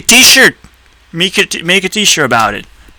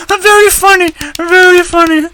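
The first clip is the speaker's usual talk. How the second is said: much higher, laughing "very funny"; sarcastic laugh; 13 semitones higher than usual. Real, not acted. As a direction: cackle then mock-praise the joke. Sarcastic reaction to own joke.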